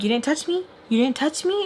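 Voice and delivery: high, challenging repeated question; mock yell with no added volume; retold yelling. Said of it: "I'm still yelling".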